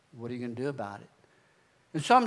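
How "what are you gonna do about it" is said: low, soft, quick repeated question; echo in the head. Retold or imitated. God's question echoing in his head, low and soft. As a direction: murmur it like a nagging inner voice. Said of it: "that echo in my head".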